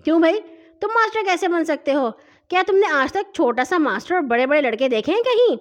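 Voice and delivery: heated bullying questioning; angry. A narrator's reading. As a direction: scold the smaller boy for presuming. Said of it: "غصے سے بولا".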